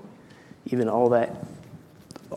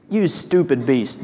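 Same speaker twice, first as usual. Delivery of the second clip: enraged; 3 semitones higher, 5 dB louder, slower than usual. Acted. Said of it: "The enraged sergeant".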